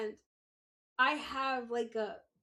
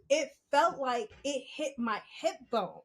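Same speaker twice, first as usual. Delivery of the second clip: ordinary delivery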